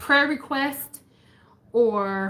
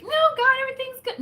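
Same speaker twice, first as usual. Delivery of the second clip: mock chirpy denial, pitched way up; fake cheerful; 13 semitones higher, much faster than usual. To God, pretending all is well, in a high mock-cheerful voice. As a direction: brush God off with a bright, fake everything's-fine. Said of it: "No need to be like".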